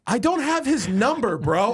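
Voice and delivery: high-pitched, exasperated retort; furious. Retold or imitated. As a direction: fire it back, fed up and furious. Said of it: "I was so furious".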